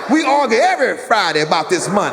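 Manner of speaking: put-on a dad berating his kid; scolding dad. Dad continues scolding, shouting.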